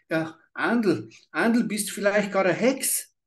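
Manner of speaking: put-on high, weepy little girl's voice; crying child. A narrator's reading. Terrified girl asks the old woman if she is a witch, weeping. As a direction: ask through tears.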